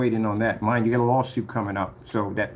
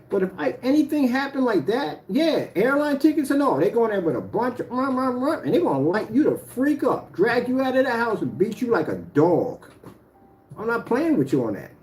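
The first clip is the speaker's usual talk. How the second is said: higher-pitched, heated warning; heated threat; 8 semitones higher than usual. Real, not acted. Warning Jamie what his family would do, heated. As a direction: lay down a deadly serious warning. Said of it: "I don't really talk like this on camera".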